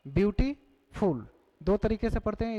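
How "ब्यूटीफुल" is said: "ब्यूटीफुल" broken in two, ब्यूटी, pause, फुल; split into parts. Shown as a demonstration. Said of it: "दो syllable नजर आ रहा है". ("ब्यूटीफुल" को दो हिस्सों में तोड़कर, ब्यूटी… फुल)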